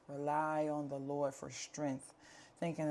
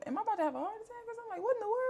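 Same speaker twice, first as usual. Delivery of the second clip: much higher and very fast, retold panic; panicked; much faster than usual. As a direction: rattle it off in a frightened rush. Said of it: "I'm literally like".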